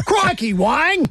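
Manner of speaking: Crikey stretched high and slow; hammed-up Aussie outback exclamation; exaggerated Australian accent. Shown as a demonstration. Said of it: "in an Australian accent".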